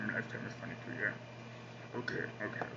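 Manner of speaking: hushed whisper, barely voiced. Real, not acted. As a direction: drop to a secret whisper. Hushed chat after "be quiet.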